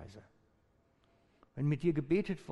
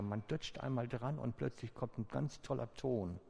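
ordinary talk

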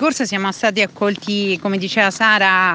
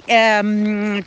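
usual speaking voice

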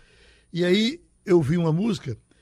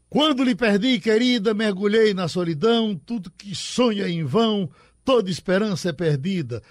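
higher and louder declaiming of the verse, falling away on the last line; reciting a love verse with full feeling, settling at the end; poem recital; 3 semitones higher, 4 dB louder than usual. Acted. Reads the repente verse aloud.

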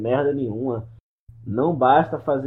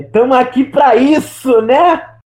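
mock rallying cry; 12 semitones higher, 13 dB louder, slower than usual.

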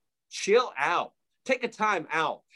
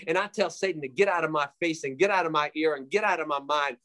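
ordinary talk

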